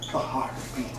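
hushed breathy whisper. A narrator's reading. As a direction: breathe it out, barely audible. Whispered line in tense tale.

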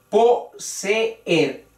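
"Poseer" slowly in three syllables, po-se-er; hiato marcado. Shown as a demonstration. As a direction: break the two e's apart in "Poseer". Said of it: "ahí vemos la ruptura". ("Poseer" separado en sílabas: po-se-er)

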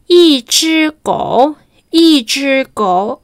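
"yi zhi gou" said slowly, rising at the end; slow sentence demo. Shown as a demonstration. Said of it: "la primera oración es".

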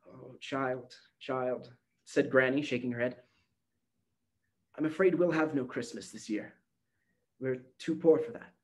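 lightly voiced grandmother, sorrowful; a gentle, tired grandmother letting her down; old granny, softly sad. A narrator's reading. Granny sadly tells Gretchen there will be no Christmas.

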